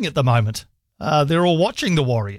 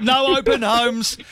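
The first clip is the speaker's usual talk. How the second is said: gleeful echo; 7 semitones higher, slower than usual. Real, not acted. Host gleefully echoing caller.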